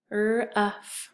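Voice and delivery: "R-uff" as separate er, uh, f; tapping out sounds. Shown as a demonstration. Teacher tapping out a syllable, sound by sound. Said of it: "we're gonna tap it out".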